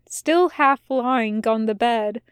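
usual speaking voice

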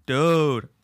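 normal talking voice

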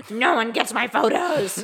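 slow snarling gremlin; angry gremlin voice. Acted. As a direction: growl it like a greedy gremlin. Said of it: "my angry gremlin voice".

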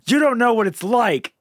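acted scream. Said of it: "screams at Waka and goes".

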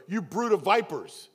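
mock street-corner scream; street preacher screaming. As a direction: yell it like a street preacher pointing at you. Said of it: "some guy standing out there screaming at you".

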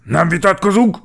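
high, loud officer's command; strict, forceful order; stern. A narrator's reading. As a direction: cut off the argument sternly and loudly. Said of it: "mondta Gepárd Géza szigorúan".

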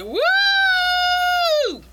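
long, very high-pitched mock "woo"; imitating a woman's ecstatic holler; ecstatic whoop. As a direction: sustain a high squealed whoop, then drop. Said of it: "she's just like".

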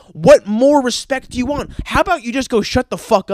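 angry. Real, not acted. Ranting at LeBron.

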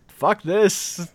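hammed-up monster quitting; mock exasperation. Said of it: "It's like".

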